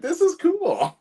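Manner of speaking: high delighted squeal, laughing; excited. Retold or imitated. Actor thrilled at getting the old-man role, high happy squeal. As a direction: squeal it like you just got the part. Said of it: "I was like".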